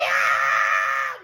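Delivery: long, very loud scream; full-volume screech. Acted. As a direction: let out a huge sustained shriek. Acts out reaction at meeting band.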